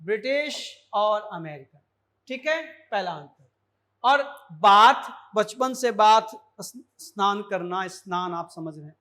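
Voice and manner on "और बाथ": बाथ drawn out, loud and slow; slow loud bath. Shown as a demonstration. Teacher stressing बाथ loudly for students. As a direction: stretch out बाथ as a long open baath. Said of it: "अंतर देखिएगा".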